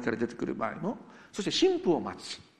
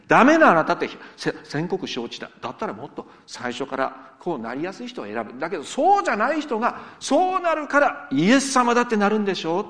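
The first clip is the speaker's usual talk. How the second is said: emphatic voiced quote; 4 semitones higher, 8 dB louder than usual. Jesus voiced addressing disciples.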